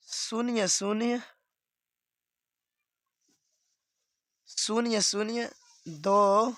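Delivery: very slow, long pauses before शून्य शून्य and दो चार; slow demo read, the decimal digits one by one. Shown as a demonstration. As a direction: spell out each digit after दशमलव slowly. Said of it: "कैसे पढ़ेंगे इसको".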